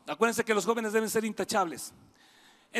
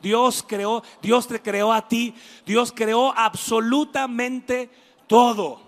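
louder preaching, stretched on todo; preacher feeding the congregation a phrase to repeat, louder; emphatic declaration; 5 dB louder, slower than usual. Shown as a demonstration. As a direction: say it firmly to your neighbour. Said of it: "Voltea y dile".

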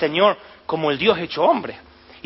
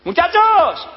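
high, loud call across the water; shout from the shore; 11 semitones higher, 5 dB louder, much slower than usual. Acted. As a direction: call out to the boat far away. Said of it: "el Señor le grita a la orilla".

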